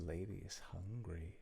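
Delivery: low, very soft in-character murmur; quietly menacing character. Acted. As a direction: let it out low and hushed, as the hungry lady. Game master voices the hungry lady.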